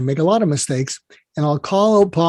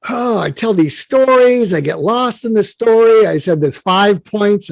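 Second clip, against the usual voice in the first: mock lament; 5 semitones higher than usual. Protégé confesses his interview slip to mentor, mock lament.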